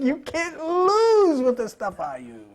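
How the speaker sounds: slow, lightly voiced showman preacher; a hint of a flamboyant TV preacher; Reverend Ike. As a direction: sell the slogan like a TV evangelist. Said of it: "Remember, Reverend Ike".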